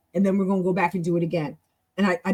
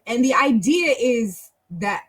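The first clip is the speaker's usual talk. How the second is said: usual speaking voice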